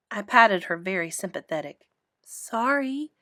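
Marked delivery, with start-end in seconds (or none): none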